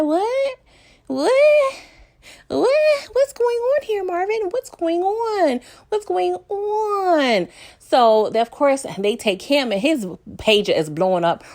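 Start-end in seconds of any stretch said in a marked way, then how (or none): 0.0-7.5 high, drawn-out disbelieving "what"; hammed-up stunned shock, slowing down at the end; mock shock. Own shocked reaction to a warrant.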